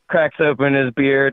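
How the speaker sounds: normal talking voice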